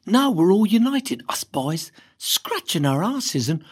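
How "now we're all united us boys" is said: ordinary delivery, nothing marked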